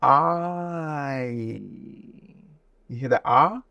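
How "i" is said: I stretched slowly, ah sliding to ee; drawn-out I. Shown as a demonstration. Teacher demonstrating the diphthong in I, slowly. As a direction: show both sounds inside I. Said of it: "go to the letter I".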